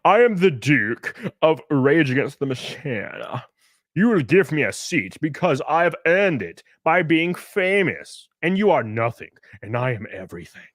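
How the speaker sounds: pompous self-styled Duke; haughty diva. Acted. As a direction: play a puffed-up celebrity demanding special treatment. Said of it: "Acting like a, a woman".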